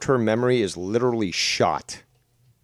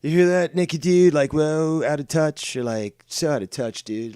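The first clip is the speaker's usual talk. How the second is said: high, loud mock whine at the start, easing off; mocking dude-speak, pitch sliding back down; mocking bro impression; 5 semitones higher, 5 dB louder than usual. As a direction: mimic a complaining streamer dude. Mimicking the streamer in the clip.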